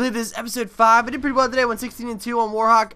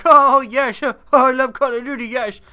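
hammed-up gushing, louder and higher; mock ecstasy; 4 semitones higher, 5 dB louder than usual. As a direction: play-act a player lost in bliss. Mimics a player flailing in ecstasy.

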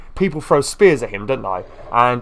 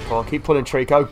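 usual speaking voice

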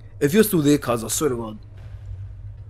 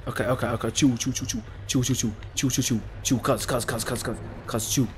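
hushed self-calming; 6 semitones lower, 10 dB quieter than usual. Real, not acted. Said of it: "I'm still screaming".

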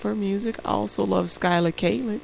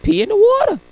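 mock father's call; 13 semitones higher, 11 dB louder than usual. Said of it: "so he's like".

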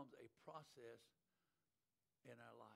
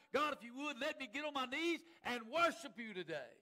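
impassioned preacher, rising higher and louder; full-force preaching; 7 semitones higher, 11 dB louder than usual. Real, not acted. Preacher pleading with God before congregation, building fervent preaching. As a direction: drive relentlessly up to a crying-out prayer.

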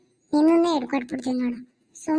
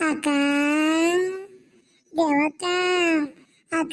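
drawn-out call to summon someone; slower than usual. Acted. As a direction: call for the ghost, stretching the last vowel. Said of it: "ಒಂದ್ ಸ್ವಲ್ಪ ಕೂಗ್ಲಾ". (ಎಳೆದು ಕೂಗಿ ಕರೆಯುವುದು)

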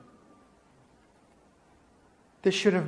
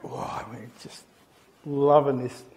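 gleeful whisper; 3 semitones lower than usual. Retold or imitated. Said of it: "We're going".

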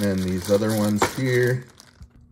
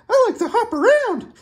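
much higher, squeaky hyper cricket voice; tiny toy cricket, chirpy and much higher; 4 dB louder than usual. Pretend. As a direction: bounce it out like an excited little bug. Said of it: "He likes to get all hyper".

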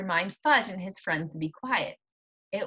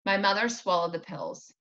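plain reading voice

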